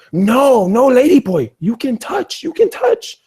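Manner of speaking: mock falsetto bar girl insisting; high squeaky broken English plea; high girly voice. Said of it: "she says".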